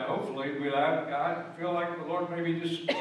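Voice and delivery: ordinary delivery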